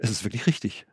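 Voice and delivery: quiet inner conviction. Retold or imitated. Preacher voicing his inner knowing, hushed and soft. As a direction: say it quietly, as a private certainty. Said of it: "dass ich sage".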